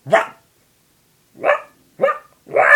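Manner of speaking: loud, abrupt amateur barking; bad dog impression. Shown as a demonstration. Podcaster redoing their worst impression, loud fake dog barks. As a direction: yap loudly in short bursts like a dog. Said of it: "犬が一番ひどかったか？".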